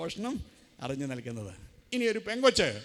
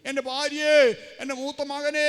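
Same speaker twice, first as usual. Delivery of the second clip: loud, higher mock wail for wife and son; mock crying out; 6 semitones higher, 8 dB louder than usual. Old man in pain calling for wife, mock loud wail. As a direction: cry it out as an old man in pain would not. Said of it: "എന്ന് പറഞ്ഞ് കരയുന്നത്".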